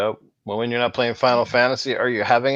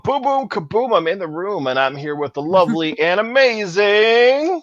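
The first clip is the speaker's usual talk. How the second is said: slow, drawn-out hype host; announcer intro; 3 semitones higher than usual. Acted. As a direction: welcome the audience like a show host. Show host opening intro.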